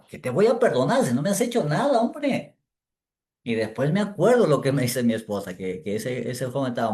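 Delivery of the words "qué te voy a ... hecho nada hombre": high, loud and fast disbelief; what on earth for, man; reacting to an apology he doesn't understand; baffled protest.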